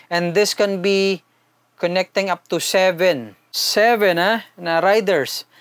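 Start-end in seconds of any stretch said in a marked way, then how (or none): none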